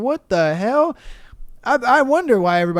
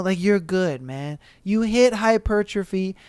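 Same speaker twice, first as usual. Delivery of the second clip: normal talking voice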